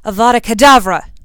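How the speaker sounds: loud, high battle cry; explosive yelled incantation; shouted curse. A narrator's reading. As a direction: shout the spell with full force. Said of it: "shouted Draco".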